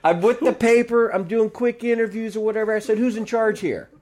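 high, emphatic fake-reporter voice; retold pushy press act; officious bluff. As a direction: brush off objections with pushy authority. Repeats bluff, demands person in charge.

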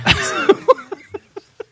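high unvoiced laugh bursting out; burst of laughter. Real, not acted. Cracking up as he finally gets the joke. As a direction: laugh out loud at getting the joke. Sudden realization about the joke.

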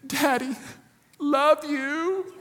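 put-on high, sugary little-girl voice, laughing; sweet toddler. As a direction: daughter charming her dad to get out. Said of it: "And then she said".

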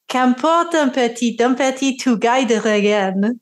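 poem read in a hammed-up French accent. Shown as a demonstration. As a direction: recite it like a Frenchman, a bit off. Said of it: "Apologies for my French accent".

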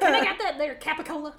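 put-on high, laughing Southern-accent "Capicola"; Southern accent Italian. Friends joking about cousins, laughing Southern-accent order. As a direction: playfully ham up a Southern twang. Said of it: "Tennessee accent".

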